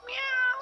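a squeaky falsetto meow; high meow. Shown as a demonstration. Owner mewing high to the cat to make him talk. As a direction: mew up high like a kitten to coax the cat. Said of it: "you speak to me".

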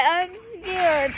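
ordinary talk

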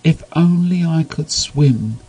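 high, slow, longing penguin; wistful penguin. A narrator's reading. Penguin Small wishing to Neverwassanoceros, high and wistful. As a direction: sigh out the wish. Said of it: "he said".

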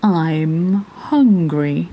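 slow, lightly voiced big whale; drawn-out, ponderous hungry whale. A narrator's reading. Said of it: "stood up on his tail and said".

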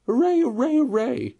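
whooping celebration; jubilant cry. A narrator's reading. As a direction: run of high, loud cheers. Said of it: "Suddenly the rat cried".

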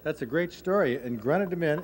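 usual speaking voice

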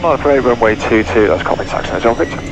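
ordinary delivery

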